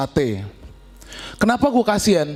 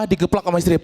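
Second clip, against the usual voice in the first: ordinary talk, nothing marked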